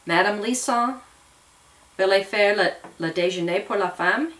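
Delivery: politely asking in French; a courteous request in French. A narrator's reading. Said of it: "he asked politely".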